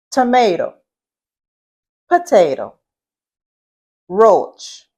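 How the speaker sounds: "Tomato, potato, roach" said singly in a Southeast Louisiana accent; own Louisiana pronunciation. Shown as a demonstration. Teacher showing students her accent, "Tomato, potato, roach" in Louisiana pronunciation. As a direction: show how "Tomato, potato, roach" sound in Louisiana, each word set apart. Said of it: "say it how I pronounce the word".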